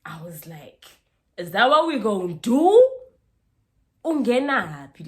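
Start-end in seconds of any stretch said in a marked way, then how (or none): 1.4-5.1 very loud, high indignant question; confrontational challenge. Retold or imitated. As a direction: fire it at her as a loud challenge. Retold confrontation in a fight.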